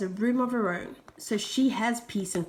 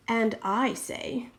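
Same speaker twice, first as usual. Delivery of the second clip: usual speaking voice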